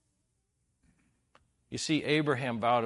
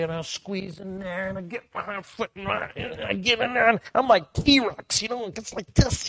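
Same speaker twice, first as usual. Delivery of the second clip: straining squeeze; 9 semitones higher, 9 dB louder than usual. Retold or imitated. Cramming into tiny tent.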